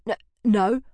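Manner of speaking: nervous stutter, caught out; stammering no. Acted. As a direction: stumble over the denial. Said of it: "Michael stammered".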